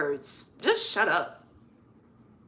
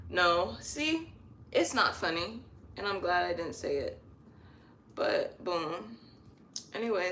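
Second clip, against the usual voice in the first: usual speaking voice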